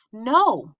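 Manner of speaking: a panicked outburst that slips out; Ruby blurting out to stop her friend, loud and high; sudden cry. A narrator's reading. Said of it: "I cry before I can stop myself".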